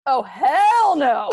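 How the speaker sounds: slow loud outraged oh hell no; real loud indignation. Real, not acted. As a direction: let the disbelief boom out slowly. Reacting to rude email.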